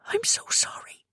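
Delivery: soft, voiceless apology; whisper. Acted. Abby whispering again to Lucky, voiceless. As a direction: barely breathe the words out.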